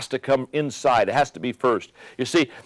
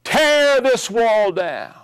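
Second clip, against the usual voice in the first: much higher, very slow demand; Reagan in Berlin; 8 semitones higher, slower than usual. Retold or imitated. Speaker quoting Reagan in Berlin, slow higher commanding demand. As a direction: drag out each word like a challenge at the wall. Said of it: "Ronald Reagan in Berlin saying".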